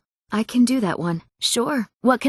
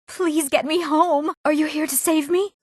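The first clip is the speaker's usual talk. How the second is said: pleading captive; 8 semitones higher than usual. Acted.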